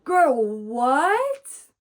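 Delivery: loud, high shocked yell; outraged disbelief. Real, not acted. Reader shouting at the book, loud shocked disbelief. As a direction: blurt it out in sheer disbelief. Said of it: "outraged reaction to book".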